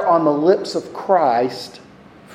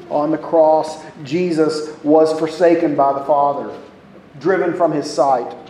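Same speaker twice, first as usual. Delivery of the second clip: ordinary talk, nothing marked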